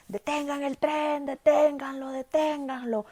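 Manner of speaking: grito imitado. Retold or imitated. Guardia grita desde afuera.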